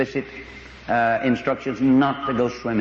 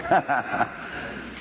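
short soft chuckle; 5 dB quieter than usual. Real, not acted. Chuckle after his line.

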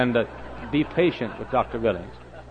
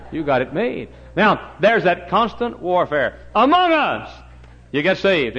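high, loud pulpit cry; full-force preaching; 7 semitones higher, 8 dB louder than usual. Acted. Preacher exhorting the congregation about battles, loud and high. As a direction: preach it hard, climbing high. Preacher drives the point home.

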